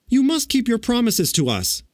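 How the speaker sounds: put-on high, insistent girl's protest; exclaiming. A narrator's reading. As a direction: demand it in a high girlish voice. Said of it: "exclaimed Dorothy".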